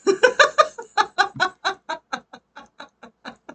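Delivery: high, helpless burst of laughter; long laugh. Real, not acted. Reader laughs at teenage Jossie's deck choice, long laugh. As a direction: laugh long, tailing away gradually.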